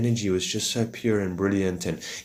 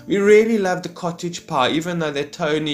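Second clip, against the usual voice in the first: high, put-on thanks, starting loud; mock gushing guest; 8 semitones higher, 4 dB louder than usual. Friend saying to the host as they leave, high mock gushing. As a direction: thank the host with overdone sweetness. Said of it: "when you hear the high-pitched voices".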